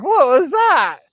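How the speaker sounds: high, loud startled yelp; startled reaction. Retold or imitated. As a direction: blurt it out in alarm. Retold shock at the crow.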